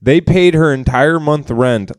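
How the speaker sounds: ordinary talk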